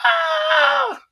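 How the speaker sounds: very high piercing eagle screech; high eagle cry. Retold or imitated. Speaker showing the audience the eagle sound, high shriek. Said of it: "And that's the eagle sound".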